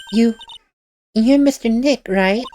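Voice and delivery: put-on high girlish voice, hesitant greeting; shy little girl Pearl. Pearl greets Nick back at the office, shy high girl voice.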